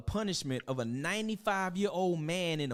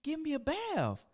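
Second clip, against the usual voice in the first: much higher, timid pleading voice; childish pleading prince; 14 semitones higher than usual. Acted. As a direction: ask mama like a little boy. Lavelle asking mama permission.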